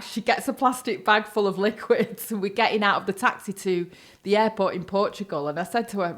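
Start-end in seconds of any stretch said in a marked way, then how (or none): none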